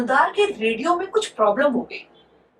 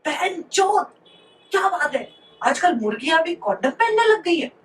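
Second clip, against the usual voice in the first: mock yell; 6 semitones higher than usual. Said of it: "तो वो जोर से चिल्ला रहा है".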